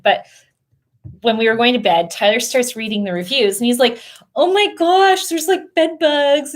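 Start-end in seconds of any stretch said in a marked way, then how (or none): 4.4-6.5 freaking out. Retold or imitated. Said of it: "he was, like, freaking out".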